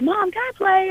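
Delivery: put-on high pleading kid voice; asking mom. Asking mom to play stickball, high childish plea. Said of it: "And I'm like".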